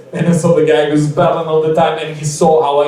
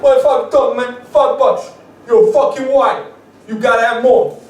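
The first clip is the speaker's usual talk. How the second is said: higher, scoffing rapper voice; mock scornful rapper; 7 semitones higher than usual. Rapper scoffs at comic's five bucks, high mock voice.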